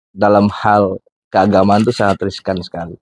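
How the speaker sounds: ordinary talk, nothing marked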